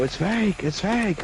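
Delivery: put-on high, frantic kid's voice; panicked kid. Teen robber pleading that the gun is fake. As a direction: squeal it out like a scared teen. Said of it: "you hear him go".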